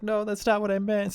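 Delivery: high, upset pleading without tears; mock tearful protest. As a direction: imitate her on the verge of crying. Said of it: "So she starts crying".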